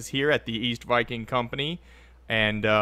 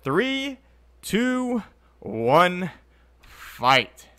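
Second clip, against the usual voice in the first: countdown call; 2 semitones higher, much slower than usual. Pretend. Countdown to start the fight.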